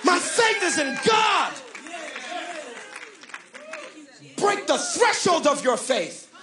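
fired-up preacher, punching the phrases; full-force preaching. Real, not acted. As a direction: build into a shouted declaration of faith.

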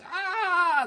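sudden high-pitched cry of pain; pained scream. Retold or imitated. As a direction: shriek like your ears are bursting. Aliens writhing in pain.

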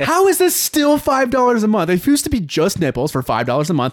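high, loud comic disbelief; incredulous outrage. Acted. Comedian as subscriber questioning the price, incredulous mock outrage. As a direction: protest in hammed-up bafflement.

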